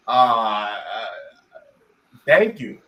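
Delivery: halting, low uhs then thanks; starstruck stammer. Retold or imitated. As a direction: imitate a tongue-tied kid on the phone. Starstruck kid stammers on phone.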